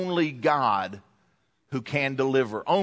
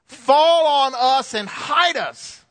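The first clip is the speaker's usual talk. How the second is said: high, slow, pleading cry of the doomed; desperate cry; 10 semitones higher, 5 dB louder, slower than usual. Acted. Preacher voicing people begging mountains, desperate high cry. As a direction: cry it out as if begging the rocks to fall.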